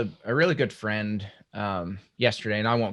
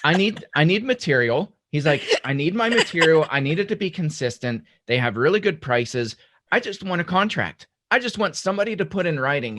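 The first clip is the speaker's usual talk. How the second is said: retold angry rant; 6 semitones higher, 6 dB louder than usual. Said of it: "he was just livid".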